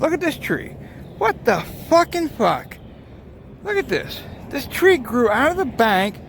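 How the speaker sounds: real stunned wonder; amazed. Real, not acted. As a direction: gasp at the sight of the tree. Amazed by strange tree.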